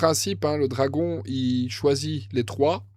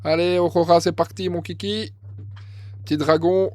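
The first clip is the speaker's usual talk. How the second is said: enthousiaste; 5 semitones higher, 6 dB louder than usual. Real, not acted. Encourage sa joueuse.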